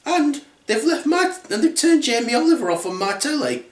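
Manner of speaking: high-pitched indignant mother bear; Mummy Bear. Acted. Mother bear about her creased chair, indignant. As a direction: complain in a put-on high voice. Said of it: "The Mummy Bear then says".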